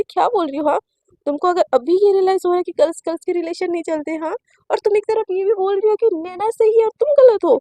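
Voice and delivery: mother confronting daughter, loud and angry. Acted. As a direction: demand an answer, furious. Mother after slapping her.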